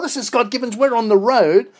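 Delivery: loud and high, full of energy; proud on-air name call; booming host intro. Real, not acted. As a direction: announce yourself like a big radio welcome. Host announces himself on air.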